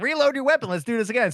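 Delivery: laughing quote. Retold or imitated. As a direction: retell it while cracking up.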